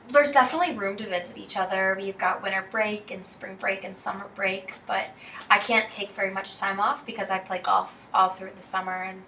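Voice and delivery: ordinary delivery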